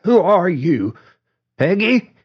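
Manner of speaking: high, tearful confusion; quivering, breaking voice. A narrator's reading. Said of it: "Mildred's voice quivered and broke".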